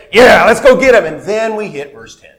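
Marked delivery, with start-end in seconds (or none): 0.1-1.0 higher, louder mock battle cry; pumped up, ready to charge; excited Moses. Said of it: "Moses being all excited".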